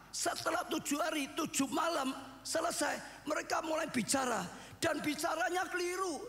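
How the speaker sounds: high, ringing retelling of the story; preaching at full force. Acted. Preacher telling how Jesus wept, fervent and high. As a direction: cry the story out to the hall. Preacher describes accusing friends.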